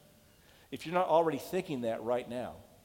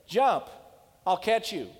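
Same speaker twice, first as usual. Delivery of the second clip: loud, higher call up through the smoke; father calling out; 5 semitones higher, 6 dB louder than usual. Retold or imitated. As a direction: shout up to the boy that you'll catch him. Father calling up to burning window.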